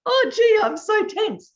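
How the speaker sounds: high, loud hammed-up whine; mock tense complaint. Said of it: "I go".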